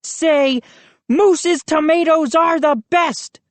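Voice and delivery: high bossy command; ordered. A narrator's reading. High domineering order. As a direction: Moose pins Joe and demands praise, bossy. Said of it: "he ordered".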